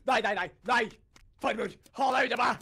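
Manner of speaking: panisk rop. Pretend. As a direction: yell at the killer to stay away. Flykter fra morderen i spillet. (panisk, høyt rop)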